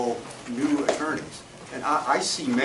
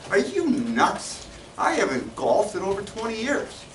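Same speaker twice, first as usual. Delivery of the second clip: a bit higher, incredulous beggar scoffing at the idea; scoffing homeless man; 7 semitones higher than usual. Acted. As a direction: play the homeless man, amazed anyone would ask.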